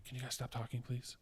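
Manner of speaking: softly asking people to stop talking; quiet polite request. Retold or imitated. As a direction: keep it hushed, a quiet plea in a cinema. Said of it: "as quiet as you can".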